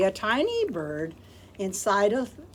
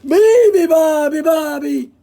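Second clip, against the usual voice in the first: loud high roaring chant; 8 semitones higher, 16 dB louder than usual. Acted. Lion roars the baby bird's song. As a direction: roar it out to the zoo. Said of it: "roared the lion".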